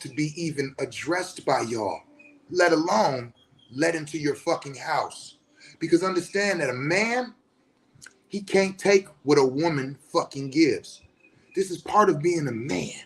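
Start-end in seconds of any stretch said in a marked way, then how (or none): none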